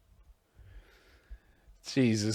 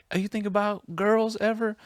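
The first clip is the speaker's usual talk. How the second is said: mock interviewer; 11 semitones higher than usual. Replays kid interview question.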